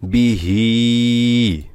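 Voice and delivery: বিহি with বি short and হি stretched long; drawn hi. Shown as a demonstration. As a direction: keep বি quick, then hold হি for one alif. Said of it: "এক আলিফ টান হবে হিতে". (বিহি বলতে বি ছোট, হি এক আলিফ টেনে)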